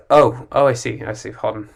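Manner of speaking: ordinary talk, nothing marked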